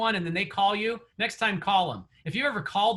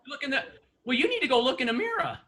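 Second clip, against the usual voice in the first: ordinary delivery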